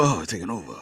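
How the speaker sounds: low, soft echo of the impression; quiet copy of the caller; mimicking the caller. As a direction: toss back his line in a hushed low voice. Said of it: "I like the last part of that".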